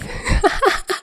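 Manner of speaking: breathy high laugh; high giggle. Real, not acted. Reader laughing at the author's end note. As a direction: laugh at your own joke.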